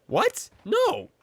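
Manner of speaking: high, squeaky protest; flustered boyfriend. Pretend. Boyfriend answers suspicious movie dad, high flustered denial.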